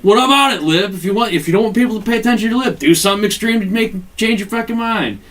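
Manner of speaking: high, loud, rushed mock rant; mock tirade. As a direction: bark it at the kid, fast and worked up. [name] berates his student.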